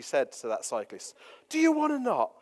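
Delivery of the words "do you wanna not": retold scream. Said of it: "is what she screamed".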